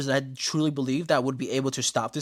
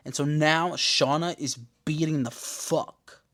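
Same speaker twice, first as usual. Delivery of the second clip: usual speaking voice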